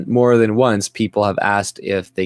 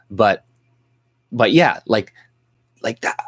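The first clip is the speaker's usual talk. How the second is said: ordinary talk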